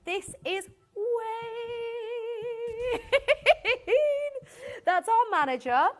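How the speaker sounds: sing-song laugh through the name; giggling as she shows the photo; presenter cracking up at her manager's picture. Real, not acted.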